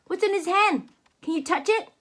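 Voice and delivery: ordinary delivery, nothing marked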